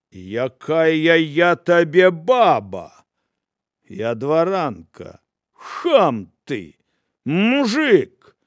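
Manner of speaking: slow, loud offended outburst; indignant scolding. Acted. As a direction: spit the insult out. Noblewoman insults apostles.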